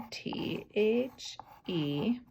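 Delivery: slow, soft spelling of T H E; slow spelled letters. Shown as a demonstration. Teacher spelling the popcorn word for her class. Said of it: "the popcorn word".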